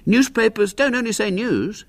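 put-on high boyish know-all tone; lightly pompous boy's wisdom; air of deep wisdom. A narrator's reading. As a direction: explain it as a wise schoolboy, pitched high. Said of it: "with an air of deep wisdom".

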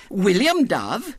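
high, slow lisping protest; indignant little girl. A narrator's reading. Girl contradicting the boys who dislike girls, indignant. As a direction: protest as an affronted small child. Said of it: "she said indignantly".

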